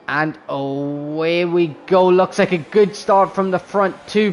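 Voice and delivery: excited, high play-by-play; motorsport commentator. Acted. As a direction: call the race start live. Live race start play-by-play.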